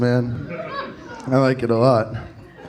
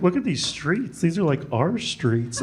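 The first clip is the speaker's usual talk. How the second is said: smug local pride; 6 dB quieter than usual. Retold or imitated. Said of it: "I was walking around today and I was like".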